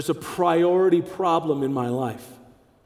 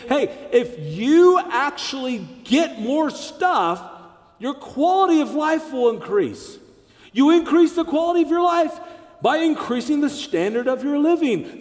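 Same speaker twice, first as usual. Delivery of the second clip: world's sales pitch; 6 semitones higher than usual. Retold or imitated. The world pitching more stuff to believers, a pushy higher sales voice. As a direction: sell it hard like an ad.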